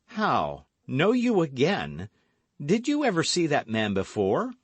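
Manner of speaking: loud, high, startled questioning; probing young gallant. A narrator's reading. D'Artagnan questions her.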